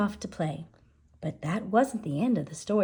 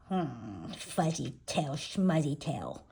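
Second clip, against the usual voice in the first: low, soft scornful grumble; dismissive declaring; 4 semitones lower, 6 dB quieter than usual. A narrator's reading. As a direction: grumble it in mockery. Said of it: "declared the kitty boy".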